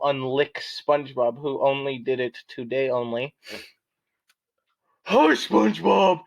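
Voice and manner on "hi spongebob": Patrick voice. Acted. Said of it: "said Patrick".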